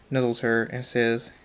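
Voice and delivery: ordinary delivery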